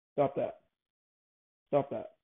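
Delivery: ordinary delivery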